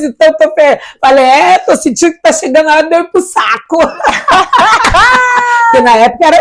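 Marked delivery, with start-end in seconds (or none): 3.8-5.6 high burst of laughter; big laugh that jumps up high; laughing hard at her own joke. Real, not acted. Laughs about going to the bag.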